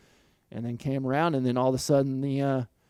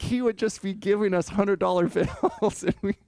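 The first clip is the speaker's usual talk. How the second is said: higher voice, laughing through the words; 7 semitones higher than usual. Real, not acted. Rider recalling easy cash from his boss, laughing. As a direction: tell it while cracking up. Laughing while telling the money story.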